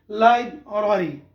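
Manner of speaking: लाइब्रेरी as "laib-rari"; slow लाइब्रेरी with an open "a" in "rari"; corrected लाइब्रेरी. Shown as a demonstration. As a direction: model लाइब्रेरी as "lai-brari". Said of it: "तो लाइब्रेरी गलत है".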